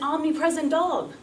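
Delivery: ordinary talk, nothing marked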